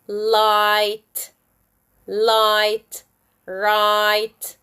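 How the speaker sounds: "light" and "right" with a long "eye". Shown as a demonstration. Teacher gives example words, long I. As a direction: draw out the vowel in "light" and "right". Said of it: "words with long I sound".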